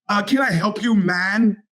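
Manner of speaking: casual dude voice calling out man; bro-ish young clerk. Retold or imitated. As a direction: play the young guy trying to be chummy. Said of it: "Try to bro down".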